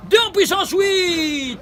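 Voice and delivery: high, slow, drawn-out exclamation; triumphant reveal. Real, not acted. Teacher reveals the result of his sum, triumphant. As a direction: call out the answer with relish.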